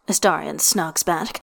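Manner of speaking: ordinary delivery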